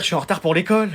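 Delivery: usual speaking voice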